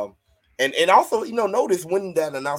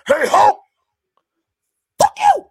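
loud, high mock shout; said with the chest; 10 dB louder than usual. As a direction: belt it out as a hammed-up insult. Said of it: "I'ma say it with my chest".

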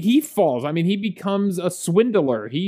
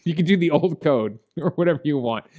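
ordinary talk, nothing marked

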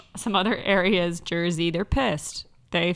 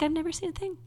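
innocent wife voice; 8 semitones higher, 7 dB quieter, much faster than usual. Retold or imitated. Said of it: "She's like".

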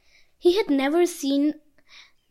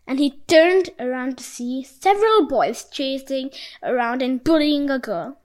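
usual speaking voice

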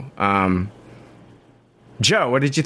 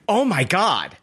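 higher-pitched mock gasp of disbelief; retold amazed reaction; 7 semitones higher, slower than usual. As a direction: act out blurting it in shock. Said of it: "audibly was like".

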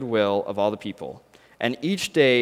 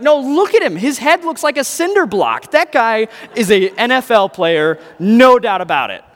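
much higher and louder, eager and sure; excited insisting; 8 semitones higher, 7 dB louder than usual. Acted. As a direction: convince your friend, all fired up. Friend insisting he's NFL.

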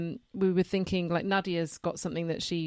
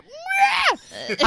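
mock exclamation; 7 dB louder than usual. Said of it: "They were like".

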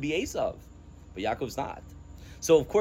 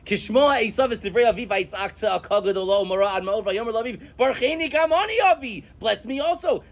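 pleading cry; 8 semitones higher than usual. Retold or imitated. Quoting Esav's cry.

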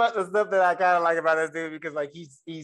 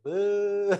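crowd boo; 5 semitones higher than usual. Retold or imitated. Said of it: "A whole crowd just".

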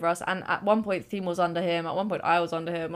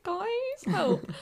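much higher, mock-panicked call for help; panicked plea. Crushed in the mosh pit, calling to her friends for help, high mock panic. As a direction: squeak it out like you're crushed in a crowd.

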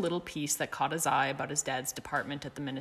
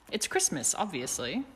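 proud and happy; 2 semitones higher than usual. Acted. Sirius proudly announcing Christmas morning to Remus. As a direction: say it like the best news ever. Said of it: "Sirius said proudly, happily".

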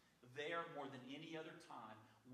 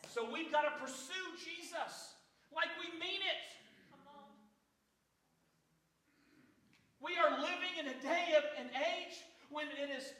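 loud, high, impassioned cry to the congregation; full-force preaching; 11 semitones higher, 15 dB louder than usual. Real, not acted. Preacher urging the church to cry out to Jesus, shouted preaching. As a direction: cry it out at the top of your preaching voice.

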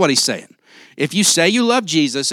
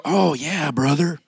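church talk; 4 dB quieter, slower than usual. Retold or imitated. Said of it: "using church talk to try to get me to go".

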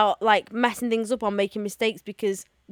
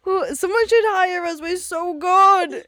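mock bragging; 7 semitones higher, 5 dB louder than usual. Joking self-praise.

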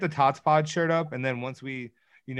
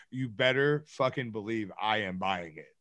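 ordinary delivery

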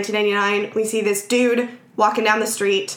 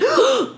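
high mock gasp of shock; sharp gasp. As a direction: gasp as if startled. Reacting to the shooting.

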